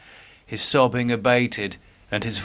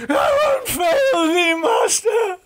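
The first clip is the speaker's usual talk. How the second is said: high, crying shout of terror; Geoffrey wakes from a nightmare, screaming; terrified scream; 4 dB louder than usual. Acted. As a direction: wake screaming in panic. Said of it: "awoke to the sound of his own screaming".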